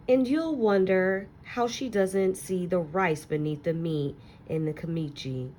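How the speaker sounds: ordinary delivery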